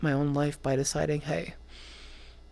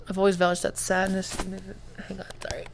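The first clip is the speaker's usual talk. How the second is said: teary, sniffly voice; snotty crying; 3 semitones higher than usual. Real, not acted. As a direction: talk through tears with a runny nose. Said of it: "I'm, like, running nose".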